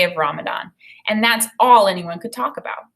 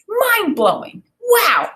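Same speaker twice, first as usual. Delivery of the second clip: pitched way up in wonder; amazed wow; 14 semitones higher, 4 dB louder, slower than usual. A narrator's reading. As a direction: gasp it out, blown away. Narrator amazed at reward.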